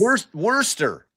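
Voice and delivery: Worcester as wor-stuh with a strong R; Worcester with the R still in it; wrong guess. Shown as a demonstration. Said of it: "Not close".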